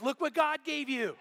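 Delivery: high, slow, triumphant retold cry. Husband shows his wife the found carton, triumphant. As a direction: crow it proudly, drawing out the words.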